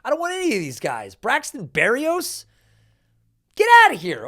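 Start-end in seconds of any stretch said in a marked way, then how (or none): 1.1-2.4 name drawn out, higher and rising; incredulous. Real, not acted. Disbelief at the draft pick. 3.5-4.3 loud, higher get outta here, falling; exasperated shout. Real, not acted. Repeats the dismissal, much louder.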